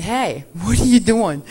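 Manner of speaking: loud, eager call down the phone; excited phone greeting. Retold or imitated. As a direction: call out to your pastor, all fired up.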